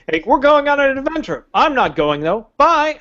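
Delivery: lightly voiced Gandalf, cheerfully ditching them; mock Gandalf. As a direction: wizard waving goodbye mid-sentence.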